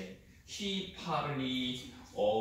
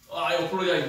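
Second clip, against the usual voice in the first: louder, heartfelt oh, I apologize; strong apology; 3 semitones higher, 8 dB louder, much faster than usual. Shown as a demonstration. Teacher shows the strong form of apologize, louder. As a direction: weigh down on oh, full of feeling. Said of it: "meaning is very, uh, strong feeling".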